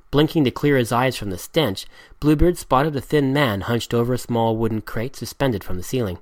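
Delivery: plain reading voice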